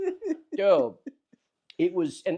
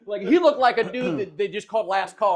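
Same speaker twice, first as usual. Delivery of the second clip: normal talking voice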